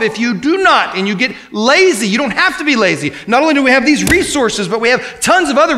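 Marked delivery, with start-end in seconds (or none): 0.0-2.1 fiery preaching. Acted. Preacher urging the church to search scripture, fiery exhortation. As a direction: drive it home to the congregation.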